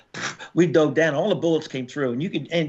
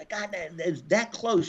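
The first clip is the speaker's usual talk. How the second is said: usual speaking voice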